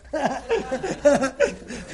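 a short run of chuckles. Real, not acted. Rabbi amused after asking a listener about pillows, chuckling. Laughs after aside to audience.

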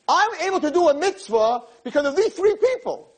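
high-pitched, fervent declaration; impassioned reply. Acted. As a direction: insist with rising religious fervor. Voicing Abraham's passionate reply.

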